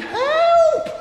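high, loud yell for help; panicked cry. Acted. Simon Peter calling to other boats, hammed-up panic. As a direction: cry out as the boat tips. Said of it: "Simon Peter was panicking".

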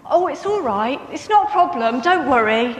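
taken aback and hurrying to say it's fine; stunned reassurance. Retold or imitated. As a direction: caught off guard, blurt out that it's fine. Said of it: "I was stunned".

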